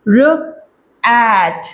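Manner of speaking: each sound stretched out very slowly; slow phonics blending. Shown as a demonstration. Phonics teacher stretching sounds slowly for parents. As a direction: draw out the vowel before saying the word. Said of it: "इस sound को extend करना है".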